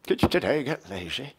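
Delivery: slurred, put-on grumbling voice; mocking grumbler. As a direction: mimic a grumbler, slurring the words. Mock repeat of own line.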